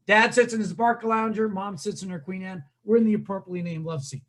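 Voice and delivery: ordinary delivery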